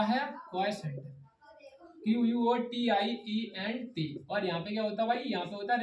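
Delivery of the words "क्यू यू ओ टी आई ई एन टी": slow spelling out. Shown as a demonstration. Spelling out quotient letter by letter.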